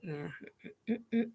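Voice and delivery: very soft hummed no; barely audible imitated "nuh-uh" hum; retold wordless refusal. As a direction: hum a small, closed-mouth refusal.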